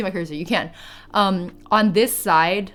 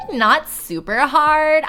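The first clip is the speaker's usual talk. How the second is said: put-on valley girl; 8 semitones higher, 5 dB louder than usual. Shown as a demonstration. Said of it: "supposed to still be doing Valley Girl Redeem".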